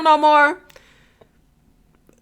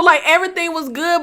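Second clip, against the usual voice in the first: ordinary delivery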